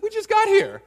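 squeaky high, loud disbelief; shocked protest. Acted. Daniel's friends protesting to the guard, high comic squeal.

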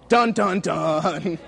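hummed suspense sting, dropping low on the last dun. Pretend. Mock ominous dun-dun-dun, sinking at the end. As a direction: play the dramatic movie cue while telling a story to a congregation.

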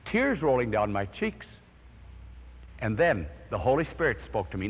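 ordinary talk, nothing marked

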